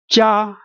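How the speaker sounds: "Jia" held high and level; high flat tone. Shown as a demonstration. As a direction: sustain "Jia" on one high note.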